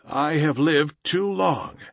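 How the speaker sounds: plain reading voice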